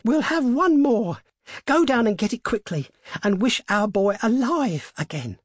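high, exultant urging; triumphant cry. A narrator's reading. Mother urging husband to wish their boy alive, triumphantly. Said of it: "she cried triumphantly".

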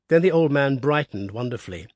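usual speaking voice

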